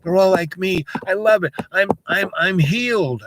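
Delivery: high, excited gushing thanks; gushing kid. Retold or imitated. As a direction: gush like an overjoyed kid. Voicing the grateful kid.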